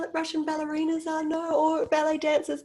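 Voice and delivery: high, outraged dismay; exasperated disbelief. Retold or imitated. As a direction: act appalled at the kids. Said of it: "And I was like".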